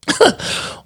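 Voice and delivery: real high-pitched breathy giggle; real giggle, airy and high. Real, not acted. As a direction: let a high giggle slip out. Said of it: "That laugh".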